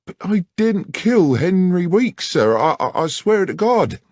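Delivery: high, loud frightened protest; desperate plea. Acted. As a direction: beg them to believe you. Beth protests her innocence.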